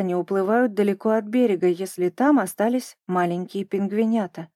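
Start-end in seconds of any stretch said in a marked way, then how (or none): none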